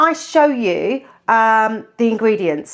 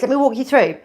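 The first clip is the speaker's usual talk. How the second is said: usual speaking voice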